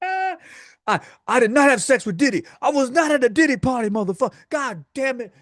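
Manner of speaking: high, loud, furious ranting imitation; Rampage Jackson impression. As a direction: rant like an outraged fighter denying everything. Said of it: "He's like".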